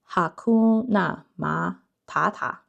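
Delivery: "Hakuna Matata" slowed with a Chinese accent, hard aspirated t in "Matata". Shown as a demonstration. Reading the Chinese card name aloud, in a Chinese accent. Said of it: "sounds like I'm saying it with a Chinese accent".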